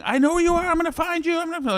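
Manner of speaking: high mock threat; badly faked high-pitched voice of a man hunting you; imitated angry pursuer. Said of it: "It didn't sound like that".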